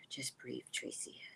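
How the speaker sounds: self-calming whisper. Real, not acted. Reminding herself to breathe, whispered to herself. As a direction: sigh then whisper to yourself.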